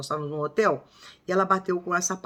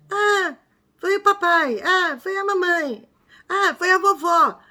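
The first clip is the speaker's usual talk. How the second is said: put-on much higher, slow little child voice; criança pequena; 10 semitones higher, 4 dB louder, slower than usual. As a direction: play the toddler blaming everyone in turn. Criança responde à mãe. (voz de criancinha bem aguda e arrastada)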